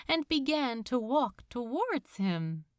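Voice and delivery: plain reading voice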